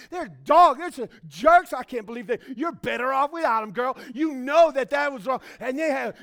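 put-on loud, high, fired-up girl talk; girlfriends venting. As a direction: hype up your friend against her ex. Friends trash-talking an ex.

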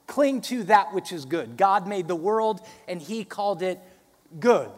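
loud building sermon climax; preaching at full force. Acted. Preaching emphatic.

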